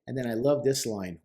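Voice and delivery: ordinary talk, nothing marked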